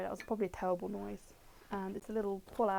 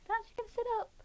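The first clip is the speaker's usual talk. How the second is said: very high, cooing pet voice; talking to a pet. Real, not acted. Streamer tells the waking Taz to sit up, high pet voice. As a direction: pitch it right up for the animal.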